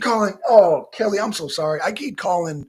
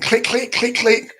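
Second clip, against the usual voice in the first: quick, higher-pitched click noises; imitated handcuff clicks; 5 semitones higher, 5 dB louder than usual. Warning election cheaters how it ends, imitated clicks. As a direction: mimic cuffs ratcheting with rising, punchy clicks.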